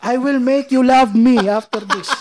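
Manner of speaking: Khabib. Retold or imitated. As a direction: imitate a Russian fighter's trash talk, slow and high. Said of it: "Ganun siya".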